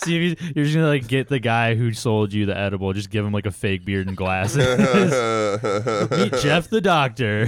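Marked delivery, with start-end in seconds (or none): 4.6-7.4 mock grand introduction. Mock introducing fake doctor.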